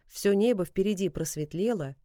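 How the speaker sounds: plain reading voice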